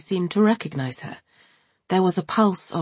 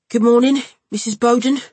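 louder nasal Cockney hello; a loud, through-the-nose Cockney greeting; Ryan's greeting; 7 semitones higher, 8 dB louder than usual. A narrator's reading. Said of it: "said Mister Topps".

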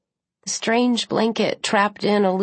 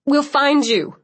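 shouting; 7 semitones higher, 4 dB louder than usual. A narrator's reading. Liam shouting from outside.

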